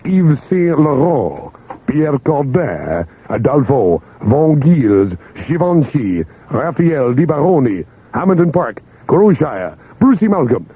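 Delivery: slow, showy designer names with "Yves Saint Laurent" drawn out; showy pronunciations. Shown as a demonstration. DJ showing off fancy designer name pronunciations. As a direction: savor each name like a grand announcement. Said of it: "ready for these pronunciations".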